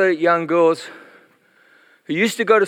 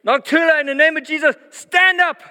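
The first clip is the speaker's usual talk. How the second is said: a high, loud command that rises to "stand up"; preacher calls her back to life; 12 semitones higher, 3 dB louder than usual. Retold or imitated. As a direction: order the dead girl up with full authority. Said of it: "Take her by the hand and say".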